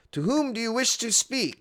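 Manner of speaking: high, prim mock-formal voice; haughty doorman asking who you want; posh mock butler. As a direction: announce it like a stuffy butler. Mock formal game prompt.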